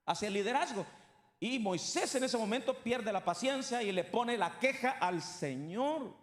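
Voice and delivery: ordinary talk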